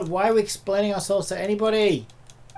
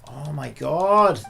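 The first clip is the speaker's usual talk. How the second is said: exasperated groan; 7 dB louder, much slower than usual. Real, not acted. Exasperated at long cutscene.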